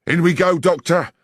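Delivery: rally him through the door; urgent. A narrator's reading. The inspector urging the doctor into the room, loud and eager. Lestrade bursting in on raid.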